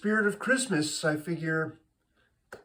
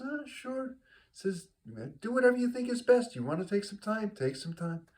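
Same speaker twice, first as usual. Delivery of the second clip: high, quick, offhand rabbi; a breezy high-pitched clergyman; 8 semitones higher than usual. Acted. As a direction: brush him off lightly as the easygoing rabbi. Rabbi answers Saul casually.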